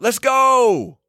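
high, drawn-out rallying yell; screamed cheer. Shown as a demonstration. Player showing enthusiasm by talking, high screamed cheer. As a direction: scream it out to fire up teammates. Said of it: "by screaming out".

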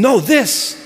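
imitating an insistent teacher; forceful. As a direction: correct the student with emphasis. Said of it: "he's forceful about it".

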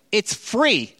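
exasperated at ungrateful guests; incredulous. Retold or imitated. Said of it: "He's like".

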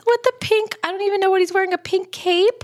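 very high, gushing squeal; moved near tears. Real, not acted. Touched by a couple's prom photo, high tearful gushing. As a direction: squeal as if overwhelmed by sweetness. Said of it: "I might cry".

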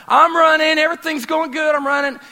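high, loud, proud runner; showing off. Acted. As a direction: call out excitedly for everyone to notice. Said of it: "you're looking at everyone going".

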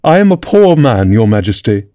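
usual speaking voice

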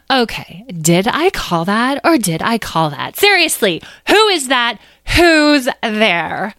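agitated demand. Acted. Amethyst challenges an unseen voice, agitated.

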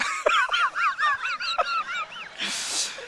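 very high, drawn-out villain cackle; Satan laughing as he comes at Paul; evil cackle. Acted.

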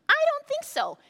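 high, loud dismissive retort; emphatic refusal. Acted. Preacher scoffs at going to the cave mouth, emphatic refusal. Answers own question with mock refusal.

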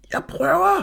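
very high, strained cry; horrified shout. A narrator's reading. Mathias tugging at Jakob's arms, shouting in horror. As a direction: shout while pulling with all your strength. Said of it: "råbte Mathias forfærdet".

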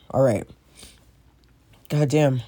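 ordinary delivery

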